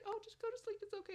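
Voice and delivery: put-on very high, babying coo; cooing mother. Mock-mothering tired students to bed.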